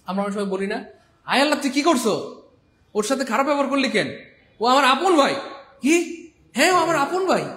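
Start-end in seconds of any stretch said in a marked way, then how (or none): 1.2-5.3 high, loud furious scolding of a friend; angry scolding. Acted. Angry sibling scolding a friend who mistreated his brother. 5.8-7.4 high, loud outraged retort; indignant outrage. Acted. Outraged sibling insisting to a friend it's his own brother.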